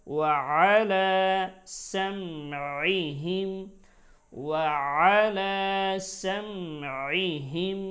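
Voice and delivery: loud measured chant with drawn-out vowels; Quran recitation. Shown as a demonstration. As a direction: recite the verse as a teacher, every letter full and sustained. Recites verse for learners. (জোরে টেনে টেনে তিলাওয়াত)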